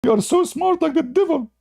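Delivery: very high, wailing curse; hammed-up crying curse; mock wailing curses. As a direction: whine out the curses like a crying man. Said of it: "He says".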